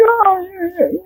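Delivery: high, thin, unconvincing reassurance; evasive. Retold or imitated. Husband hiding trouble from wife, high and evasive. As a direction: dodge the question in a small high voice. Dodging wife's question about practice.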